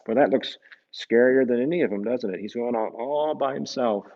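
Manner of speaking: ordinary delivery, nothing marked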